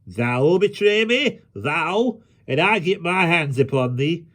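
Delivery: high, incredulous outburst; outraged threat. A narrator's reading. Hobbs threatens the boy for betraying him, high and outraged. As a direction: rise in outrage on the challenge.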